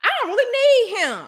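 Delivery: high, fast, airy self-assured quote; mock self-reliant woman. Woman talking to herself, airy and high-pitched. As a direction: toss it off like she's done with him. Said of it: "And now you like".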